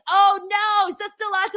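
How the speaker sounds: high, loud groaning "oh no"; real shouted dismay. Real, not acted. Hears baseball still going on.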